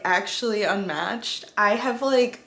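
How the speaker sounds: normal talking voice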